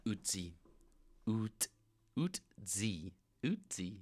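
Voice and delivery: Utzi with the consonant turned to "tz"; exaggerated Quebec pronunciation. Shown as a demonstration. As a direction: break Utzi apart, oot-dzee. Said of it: "they exaggerate, um, stuff like that".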